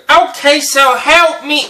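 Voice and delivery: high, loud broken English whine; delivery man stuck in the snow, high and loud in a put-on accent; put-on accent of the driver. Driver asks for help.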